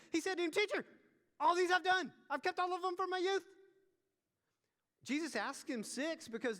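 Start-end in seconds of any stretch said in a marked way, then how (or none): 0.1-3.4 loud, high proclamation; fervent preaching. Acted. Preacher voicing the rich man's proud boast, loud and high. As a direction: belt it out to the pews.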